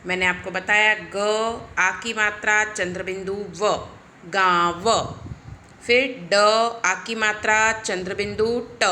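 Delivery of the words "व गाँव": गाँव stretched very slowly and loudly, nasal आँ; slow nasal demo. Shown as a demonstration. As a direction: drag out गाँव for the class, through the nose. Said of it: "उस स्वर में उच्चारित करते हैं". (गाँव बहुत धीरे और ज़ोर से)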